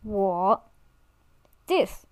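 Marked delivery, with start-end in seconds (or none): 0.0-0.6 我 said in Mandarin third tone, low and dipping. Shown as a demonstration. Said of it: "the third tone as well".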